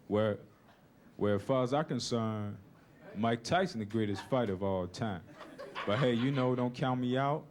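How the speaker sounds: low, halting loser's interview; dazed boxer giving a post-fight statement; battered boxer. Acted. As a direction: speak low as a fighter just beaten senseless. Acts beaten boxer interviewed after bout.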